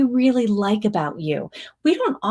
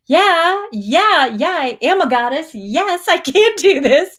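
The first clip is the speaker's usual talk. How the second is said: pumped-up affirmation; 8 semitones higher, 3 dB louder, slower than usual. Pretend. Voicing a viewer's self-belief.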